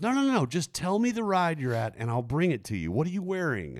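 the stranger insisting on bringing the phone, high and eager; insistent high lady voice. Retold or imitated. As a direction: push it up high, she won't take no.

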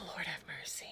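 breathy whisper of exasperation; whispered sigh. Real, not acted. Cohost whispering in exasperation at the husband. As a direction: sigh it out under your breath.

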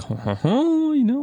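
high, held excited huhs. Retold or imitated. Tasting the Madeira, high excited little huhs.

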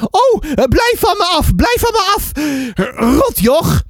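very high, panicky neighbour cry; alarmed little shriek. A narrator's reading. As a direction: fend someone off in a squeal. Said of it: "slaakte een kreetje". (hoog, geschrokken kreetje)

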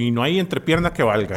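usual speaking voice

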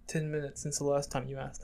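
hushed comic exchange; retold whisper. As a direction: whisper it for the punchline. Retold stoned exchange about time.

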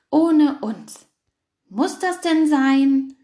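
loud, slow whiny protest; groaning complaint. A narrator's reading. Fox and hare groaning about the campfire, loud whiny protest. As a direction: drag out the complaint. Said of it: "stöhnten Flunkerfuchs und Angsthase".

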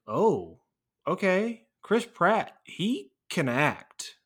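high, loud mock revelation, drawn out at the end; acting out being pleasantly won over, pitch leaping up; impressed realization. Viewer suddenly realizing the actor's talent, impressed.

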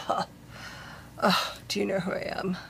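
soft, breathy haughty huff, quick; sassy attitude. Retold or imitated. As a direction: toss it off quietly, sassy and self-important. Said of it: "with just this sassy, just kind of".

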